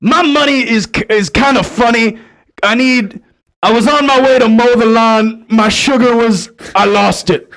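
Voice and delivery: a hint of a confused old woman; raspy old lady voice. Confused stranger rambling to him about her money, lightly raspy. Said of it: "in a real raspy voice".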